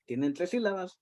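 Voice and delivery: usual speaking voice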